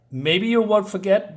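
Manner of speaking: ordinary talk, nothing marked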